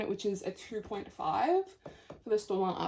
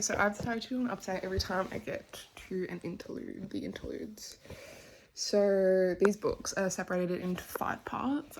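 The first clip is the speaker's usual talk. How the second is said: usual speaking voice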